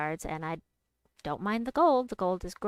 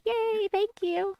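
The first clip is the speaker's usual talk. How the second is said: high, loud, drawn-out cheer; delighted thanks; 13 semitones higher, 5 dB louder, slower than usual. Real, not acted. Streamer thanking a viewer for praise, delighted high cheer. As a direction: squeal your thanks, stretched out.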